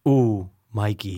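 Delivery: low, slow, creepy drawn-out call; movie line. Pretend. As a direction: stretch it out low and eerie.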